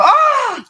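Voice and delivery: very high loud shriek; startled scream. Retold or imitated. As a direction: shriek like a hand grabbed you. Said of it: "And they'd go".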